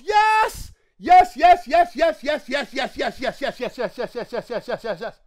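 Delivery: loud, high string of yeses fading down; excited yelling. Real, not acted. Finally pulling his wanted card, ecstatic yelling. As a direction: shout for joy, then let it wind down.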